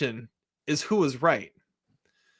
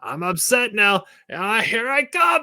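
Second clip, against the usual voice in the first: put-on high, slow, whiny old-man rant; a hammed-up, pitched-up angry old man; Don Zimmer impression; 10 semitones higher, 3 dB louder than usual. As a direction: play a grumpy old coach working himself up. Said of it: "and he's like".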